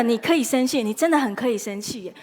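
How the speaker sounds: usual speaking voice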